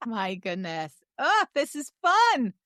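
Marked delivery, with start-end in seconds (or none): 1.2-2.5 delighted. Real, not acted. Delighted at the interview's start.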